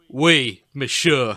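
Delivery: a fake French barman; mock French accent.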